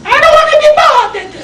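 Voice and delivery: very high, fast falsetto brush-off; falsetto queen. Retold or imitated. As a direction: squeak out a haughty queen. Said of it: "She was a transvestite".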